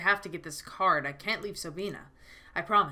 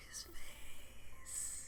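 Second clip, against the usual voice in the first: whisper; 13 dB quieter than usual. Real, not acted. Reacting to the game scene.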